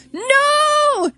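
hammed-up despairing shout; anguished cry. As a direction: cry out in cartoon horror. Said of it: "Duna and Dinal's all".

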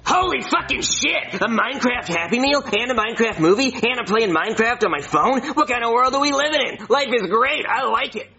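high-pitched, gushing excitement; thrilled fan squeal in words; overjoyed outburst. Acted. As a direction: geek out over amazing news. Kid thrilled by the news.

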